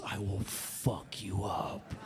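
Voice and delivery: slow, low, whispered menace; menacing threat. Retold or imitated. Ike's look says it to everyone, slow whispered menace. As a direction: breathe the threat like a dangerous man. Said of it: "personality just says".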